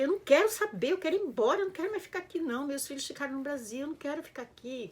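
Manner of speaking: high, loud yelling at her husband, rushing at the end; furious complaint. Retold or imitated. Wife yelling at husband in tiny flat abroad, furious. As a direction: shout that you want to go home, now. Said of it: "Comecei a reclamar".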